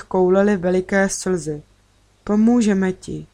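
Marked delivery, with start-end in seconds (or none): none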